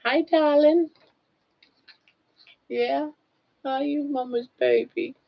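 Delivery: high, cooing pet voice. Real, not acted. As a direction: owner cooing at her pet, high and tender.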